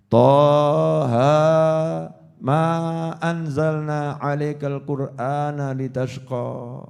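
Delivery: slow, drawn-out chanted recitation; Quran recitation. Acted. Preacher recites the verse to the congregation, slow chanted recitation.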